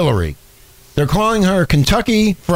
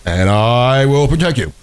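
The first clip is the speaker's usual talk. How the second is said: slow, stretched-out stump-speech parody; Obama; 2 semitones lower, slower than usual. Retold or imitated. Host mimicking campaign-trail promises, slow put-on accent. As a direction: pledge it like a drawling candidate. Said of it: "When he talked like this?".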